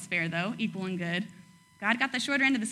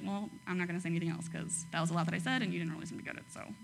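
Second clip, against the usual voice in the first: fast, softer and softer down to a whisper; mock hurt God fading to a whisper; fading mock sulk; 4 semitones lower, 9 dB quieter, much faster than usual. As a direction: trail off into a sulky whisper. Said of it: "he's like".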